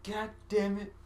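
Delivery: squeaky furious swearing; high angry cursing. Pretend. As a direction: curse like a tiny far-off voice. Distant cursing voice.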